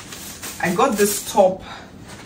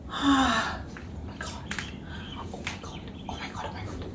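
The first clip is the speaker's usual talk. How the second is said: breathless whispered oh my God; awed whisper; 7 semitones higher, 13 dB quieter than usual. Real, not acted. Vlogger smelling the perfume, whispering in awe.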